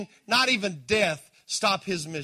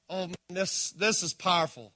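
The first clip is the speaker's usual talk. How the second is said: usual speaking voice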